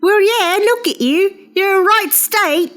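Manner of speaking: put-on Monty Python accent. Cohost takes his turn voicing the wife, fake British falsetto. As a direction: squeal it like a Python lady. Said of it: "Let me give it a shot".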